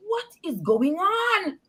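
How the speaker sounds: high, drawn-out baffled question; acting out incredulity at the chaos; exasperated bewilderment. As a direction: stretch it out high in utter disbelief. Repeats baffled question amid everybody bawling.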